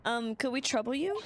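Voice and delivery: ordinary talk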